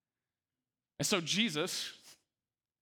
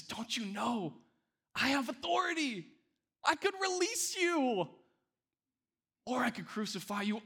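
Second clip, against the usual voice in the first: much higher, urgent and coaxing; pressing Pilate; 9 semitones higher than usual. Retold or imitated. Pilate urging Jesus to recant, high and coaxing. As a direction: Pilate leaning on his power, pitched way up.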